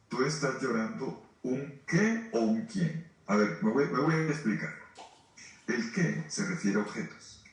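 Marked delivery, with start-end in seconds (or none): none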